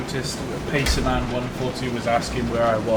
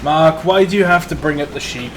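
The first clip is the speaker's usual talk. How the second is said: raised, exasperated complaint; 3 semitones higher, 4 dB louder than usual. Acted. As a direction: call Mark out in exasperation. Complaining about the sheep.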